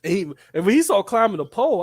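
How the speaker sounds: normal talking voice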